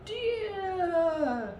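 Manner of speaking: high, long wailing cry while flying; a big bear yelling as she soars; screaming polar bear. A narrator's reading. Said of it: "screamed the polar bear".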